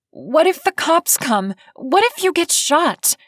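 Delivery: trying to stay calm but rising with panic; barely hidden panic. A narrator's reading. Meg to Cade about the break-in, rising panic. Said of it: "tried not to let her panic show in her voice".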